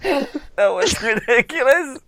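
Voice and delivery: high slow disbelief; high exasperated. Real, not acted. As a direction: drag it out in exasperation. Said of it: "reacts to pun".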